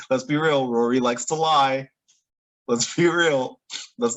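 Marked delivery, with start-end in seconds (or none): none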